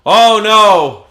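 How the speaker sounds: loud, high hammed-up cry of alarm; mock panic. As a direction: wail it in pretend horror. Pretends panic about communism.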